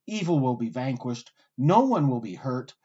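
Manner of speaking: usual speaking voice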